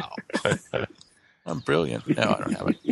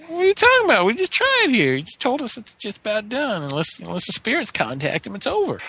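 much higher, incredulous outburst; exasperated protest; 11 semitones higher than usual. Acted. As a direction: throw up your hands in disbelief. Player's character protests loudly.

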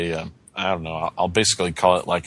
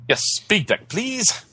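polite detective; 13 semitones higher, slower than usual. Acted. Detective asks lift operator for deck.

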